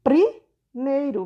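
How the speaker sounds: "Primeiro" stretched very slowly, every syllable apart; slow sounding out. Shown as a demonstration. Teacher stretches out "Primeiro" slowly for pupils. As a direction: draw "Primeiro" out slowly so the P and R are heard. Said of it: "vai ser o quê".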